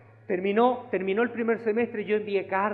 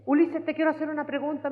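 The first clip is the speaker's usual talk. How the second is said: sister Carol asking; 9 semitones higher than usual. Retold or imitated. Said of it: "me dijo".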